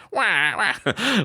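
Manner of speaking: high rising crybaby wah wah; hammed-up baby cry; mock crying. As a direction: wail it like a baby. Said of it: "it sounds like I'm just whining and crying".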